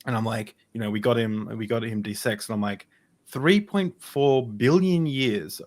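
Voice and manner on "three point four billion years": slow, slightly raised amazement; awestruck. Retold or imitated. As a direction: stretch it out in wonder. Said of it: "with awe and wonder and mystery".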